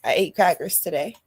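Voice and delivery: usual speaking voice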